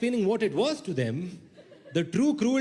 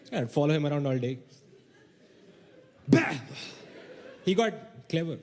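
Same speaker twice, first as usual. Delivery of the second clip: ordinary delivery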